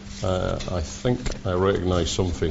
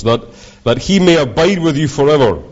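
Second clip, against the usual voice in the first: scripture reading; 3 semitones higher than usual. Acted. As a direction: read the verses out like a preacher. Reads John aloud, preaching.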